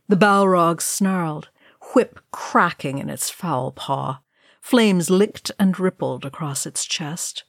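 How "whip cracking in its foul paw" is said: usual speaking voice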